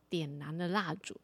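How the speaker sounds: plain reading voice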